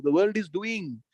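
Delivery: ordinary delivery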